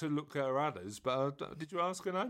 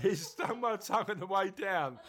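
ordinary talk, nothing marked

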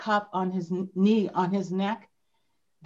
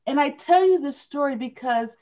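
ordinary talk, nothing marked

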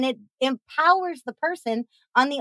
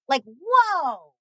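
ordinary talk, nothing marked

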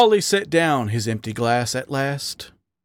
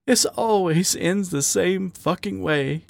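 higher-pitched, hurting lament; tearful despair; 6 semitones higher than usual. Acted. Raccoon hiding his tears from his lover, despairing. Raccoon crying after the quarrel.

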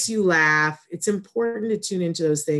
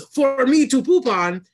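rude puppet dog voice, much higher and louder, slow, drawn-out punchline; full Triumph the Insult Dog impression; 9 semitones higher, 7 dB louder than usual. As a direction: land the insult dog's punchline after a pause, hammed up. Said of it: "there's a pause and he goes".

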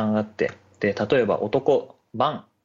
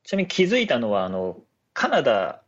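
ordinary talk, nothing marked

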